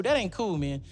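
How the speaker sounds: ordinary talk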